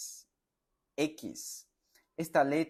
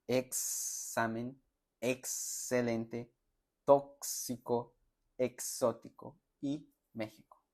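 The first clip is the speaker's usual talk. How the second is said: each word drawn out slowly for learners; slow, careful teacher's model; slow practice reading; slower than usual. Shown as a demonstration. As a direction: say the X words slowly and clearly. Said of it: "pronunciar y practicar la letra X".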